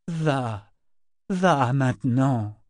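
slow, weary insistence; insistent urging. A narrator's reading. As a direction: urge her to leave, drawing it out. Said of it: "répéta Ronica pour la centième fois". (insistance lasse)

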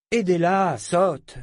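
high, loud, scornful snapped command; contemptuous order. A narrator's reading. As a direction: bark it down at her with disdain. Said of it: "ordonna-t-il avec mépris".

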